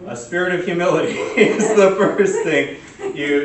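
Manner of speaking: loud, high, laughing announcement; mock proclamation. Real, not acted. Presenter correcting her forgotten first item to the audience, laughing proclamation. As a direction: proclaim it big, laughing at yourself. Jokes about forgetting humility, proclaims it.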